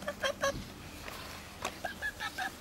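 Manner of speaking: squeaky calling to the pups; high calling. Real, not acted. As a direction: call them up high. Calling puppies.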